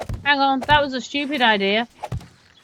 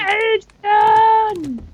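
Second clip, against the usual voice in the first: calling out; 8 semitones higher, 4 dB louder than usual. Real, not acted. Calling partner's name.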